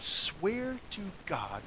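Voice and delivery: very drawn-out, each word stretched; outrageous demand. Acted. Preacher quoting the demon's outrageous oath, slow and drawn out. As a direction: stretch it slowly into a brazen oath. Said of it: "even more outrageously, the demon says".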